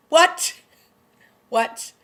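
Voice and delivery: a high, loud stunned what?; shocked disbelief. Real, not acted. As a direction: blurt it out in real shock. Reacting to the episode twist.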